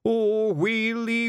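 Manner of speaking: hammed-up comic lament; drawn-out Scottish wail; wailing sob. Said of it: "he sobbed".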